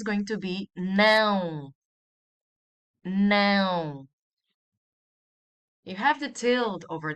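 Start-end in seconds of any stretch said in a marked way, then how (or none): 3.1-4.1 "Não" drawn out with a nasal diphthong. Shown as a demonstration. Teacher teaching the word for no, long nasal "Não". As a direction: stretch "Não" through the nose, louder. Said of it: "this indicates a nasal sound".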